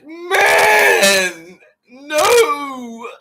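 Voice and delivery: high, loud crying shout; mock wailing shout. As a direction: wail it like a hurt man.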